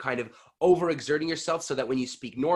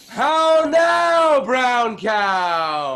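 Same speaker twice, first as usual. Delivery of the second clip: very high loud shout on How now; roof-raising shout; blow the roof off; 11 semitones higher, 10 dB louder, much slower than usual. Shown as a demonstration. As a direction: let it rip unashamed. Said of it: "blow the roof off".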